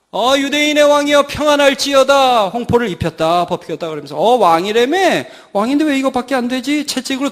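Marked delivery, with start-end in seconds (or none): 0.1-2.5 raised-pitch mock homage; sing-out taunting hail; mocking soldiers. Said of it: "조롱하고 그 다음에 예수님 놀렸습니다". 4.2-6.9 acted jeering questions; mocking soldiers. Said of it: "조롱하고 그 다음에 예수님 놀렸습니다".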